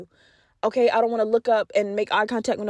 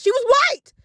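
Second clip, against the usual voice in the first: blurted out; 3 dB louder, slower than usual. Real, not acted. She blurts the lady's race to her viewers, high and loud.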